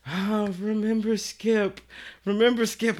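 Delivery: high, drawn-out angel voice of the conscience; slow, high mock-angelic reminder; pleading angel. Angel bubble of Leroy pleading.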